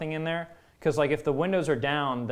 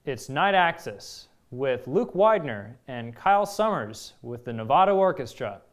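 show announcer; 2 semitones higher, 5 dB louder than usual. Acted.

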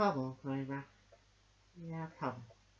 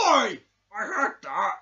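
loud offended "Oi!"; indignant shout; 5 semitones higher, 13 dB louder, slower than usual. Acted. Toy shouting back at the host, indignant. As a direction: call out the insult. Toy objects to being named.

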